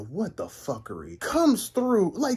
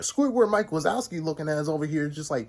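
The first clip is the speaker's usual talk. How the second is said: ordinary talk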